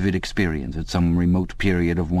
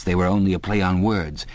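ordinary delivery

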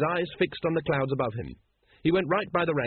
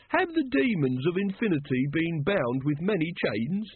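higher-pitched ritual question; intoned; 5 semitones higher than usual. A narrator's reading. Brother Watchtower continuing the lodge ritual, intoned. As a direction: intone it like a solemn rite. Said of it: "intoned Brother Watchtower".

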